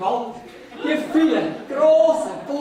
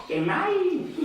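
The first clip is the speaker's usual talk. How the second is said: mocking echo; 6 semitones higher, 4 dB quieter than usual. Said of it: "Ich find's gemein".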